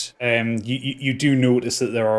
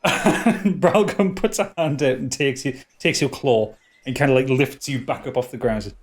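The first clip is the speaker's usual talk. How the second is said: ordinary talk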